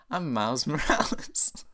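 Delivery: slow cartoon-hero impression breaking into laughter; amateur impression, cracking up; Miles Morales. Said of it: "that's how I do my Miles voice".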